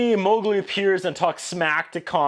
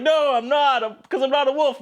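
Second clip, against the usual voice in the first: mock kid refusal; 9 semitones higher than usual. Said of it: "And he's like".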